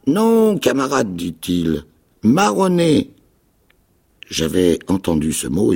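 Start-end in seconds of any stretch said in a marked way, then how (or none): 0.1-3.1 high, loud cracked castaway voice; a wild islander, half mad and eager; odd castaway. A narrator's reading. Marooned man tells the boy his fate, high and loud. Held Ben Gunn voice.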